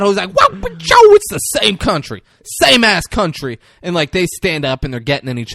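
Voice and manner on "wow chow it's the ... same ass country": high, loud mock squabbling; hammed-up imitation of a heckler; mocking argument. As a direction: squawk it, then insist loudly. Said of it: "He's doing that".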